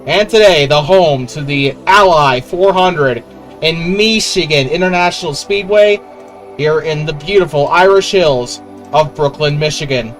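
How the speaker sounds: motorsport commentator. Acted. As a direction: race announcer opening a broadcast. Race broadcast intro.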